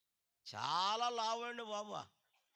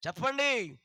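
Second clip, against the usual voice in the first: loud, driving sermon delivery; preacher rousing the congregation to answer; full-force preaching; 6 semitones higher, 15 dB louder than usual. Acted. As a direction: preach it out to the whole hall.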